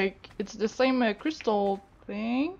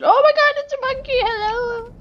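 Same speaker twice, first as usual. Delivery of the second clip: surprised shriek; 12 semitones higher, 8 dB louder than usual. Real, not acted. Sudden monkey appears in game.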